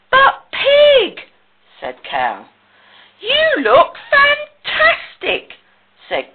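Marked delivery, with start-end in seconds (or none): 0.1-1.3 high, loud cry of surprise; squeaky-high astonishment; amazed exclamation. Acted. Cow amazed at clean Pig. 3.2-5.6 very high, loud, drawn-out compliment; stretched-out, high-pitched enthusiasm; delighted admiration. Acted. Goat admires the clean Pig.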